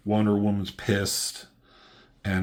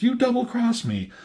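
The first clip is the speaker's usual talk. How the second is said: much higher, pitched-up mock outrage; pissed-off accusation; 3 dB louder than usual. Luthor accusing the double-crosser, mock outraged and high. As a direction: throw the betrayal back at him, hammed up. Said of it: "Luthor's all pissed".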